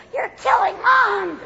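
put-on high, drawn-out child's wail; horrified child. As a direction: cry out like a little kid walking in. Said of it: "little face will peek in and go".

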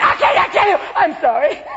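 fretful wife falling apart; hormonal wife. Acted. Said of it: "She'll be going".

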